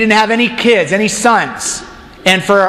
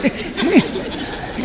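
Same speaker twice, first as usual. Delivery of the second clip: breathy giggle; 10 semitones higher, 7 dB quieter than usual. Acted. Abraham overwhelmed by stars.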